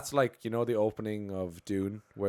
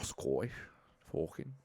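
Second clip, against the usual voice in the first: low, soft rough Scottish; hesitant old Scots impression; old-time Scottish accent; 5 semitones lower, 7 dB quieter than usual. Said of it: "minus two hundred years".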